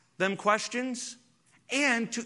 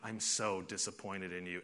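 much softer, hurt and let down; a quiet, wounded parent; disappointed parent; 10 semitones lower, 13 dB quieter than usual. Retold or imitated. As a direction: say it like a parent whose heart sinks. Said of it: "they said".

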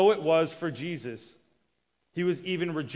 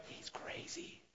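much softer whisper; whispered aside; 21 dB quieter, slower than usual. Retold or imitated. Jesus' brothers whispering that he is crazy. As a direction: say it behind his back.

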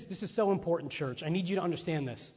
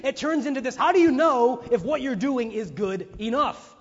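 normal talking voice

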